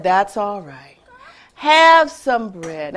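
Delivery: low, slow and kind; forgiving hen. Acted. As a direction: reassure the sorry friends. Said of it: "And she said".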